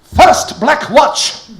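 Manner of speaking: English humorist shouting back to the Germans in a put-on Scottish accent; shouted Scottish accent. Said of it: "shouted back with a terrific assumption of accent".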